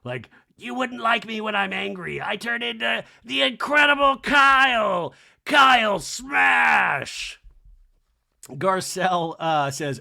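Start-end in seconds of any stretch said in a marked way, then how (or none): 0.6-7.4 high, building to loud slow roar; hammed-up monster threat, swelling to a shout; Incredible Hulk. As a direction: stretch the last words into a mock roar. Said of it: "like she's the Incredible Hulk".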